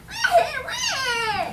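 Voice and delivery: put-on high, loud baby crying; baby wail. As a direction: wail like a newborn. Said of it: "heard Princess Prillipat wail".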